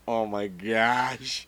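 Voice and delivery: slow, drawn-out eye-roll groan of words; exasperated Virgil. Retold or imitated. Co-host imagines Virgil rolling his eyes at a botch, exasperated. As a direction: imitate an exasperated wrestler, dragging it out. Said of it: "could almost hear him saying".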